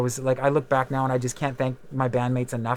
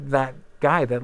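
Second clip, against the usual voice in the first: normal talking voice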